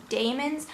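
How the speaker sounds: "daemons" with an AY as in day; DAY-mons pronunciation. Shown as a demonstration. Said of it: "I wanna say daemons".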